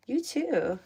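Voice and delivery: ordinary talk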